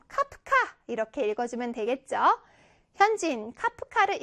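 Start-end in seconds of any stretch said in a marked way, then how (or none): 0.1-0.5 called out as natural speech; like really speaking. Shown as a demonstration. Teacher demonstrating quoted speech, as if really said aloud. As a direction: say it as if really calling it for a photo. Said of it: "실제로 말하는 것처럼".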